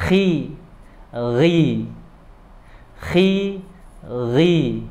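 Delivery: khi and ri said light and thin. Shown as a demonstration. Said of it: "dibaca tipis ketika kasroh". (khi ri dibaca tipis)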